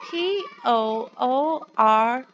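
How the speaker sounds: put-on slow, clear letter-by-letter spelling for children. Shown as a demonstration. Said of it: "can you say it with me".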